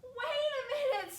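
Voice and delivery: high, loud excited shout; sudden high-pitched call. Acted. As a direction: holler it as a surprised brother. Brother calls out on discovery.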